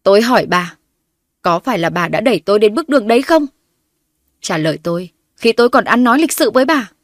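loud, demanding; snapping. A narrator's reading. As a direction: lose patience and snap. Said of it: "mà gắt lên".

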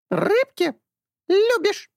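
high squawking bird; eager parrot squawk. A narrator's reading. Talking bird answers. (птичий писк)

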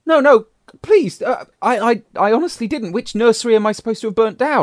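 high frantic begging; pleading. Pretend. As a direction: beg for mercy, voice up high. Accused man pleading.

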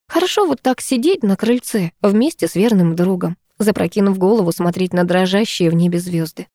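ordinary delivery, nothing marked

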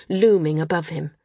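ordinary delivery, nothing marked

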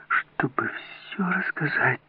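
slow, whispered entreaty; faint, imploring breath; pleading. A narrator's reading. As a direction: beg him in a drawn-out whisper. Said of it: "молила она".

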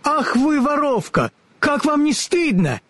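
put-on high girlish voice, indignant; scolding little girl. A narrator's reading. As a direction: scold her like an upset child. Elli scolds the witch.

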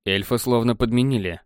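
ordinary delivery, nothing marked

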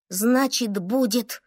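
ordinary delivery

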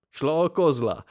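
loud burst, barely containing amusement; holding back laughter. A narrator's reading. Friend correcting him about the verse, holding back laughter. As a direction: force it out before cracking up. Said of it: "vypravil ze sebe Vilém a vyprskl smíchy".

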